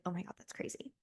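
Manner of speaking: very soft awed aside; barely voiced mock awe; hushed mock amazement. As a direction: murmur it like an impressed onlooker. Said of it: "you guys are gonna be like".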